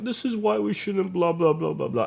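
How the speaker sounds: high-pitched parody of a speech; mock speechmaking. Student retelling his class speech, mock speechmaking.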